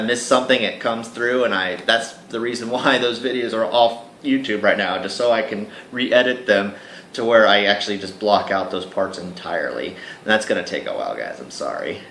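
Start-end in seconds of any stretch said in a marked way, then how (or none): none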